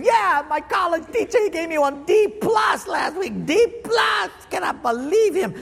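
high-pitched, hammed-up complaining; mock indignation. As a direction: squeal the grievance like an aggrieved student. Voices indignant student complaining.

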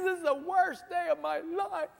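high, wailing sob-voice of a broken man; crying man. Retold or imitated. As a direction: whine it out like you're about to burst into tears. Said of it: "this guy starts crying profusely".

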